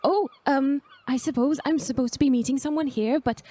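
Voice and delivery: lightly voiced hesitant young traveler, quick in bursts; a hint of a nervous novice; unsure young Agnar. Novice explaining she awaits someone unknown, mildly unsure.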